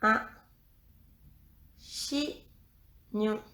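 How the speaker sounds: "chignon" split in two, chi, pause, gnon; slow dictation of "chignon" with a gap inside; syllable by syllable. Shown as a demonstration.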